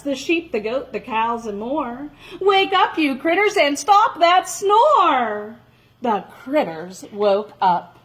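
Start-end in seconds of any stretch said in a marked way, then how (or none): 2.4-5.6 loud call. Acted. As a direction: call out loud to wake her. Calling to the animals.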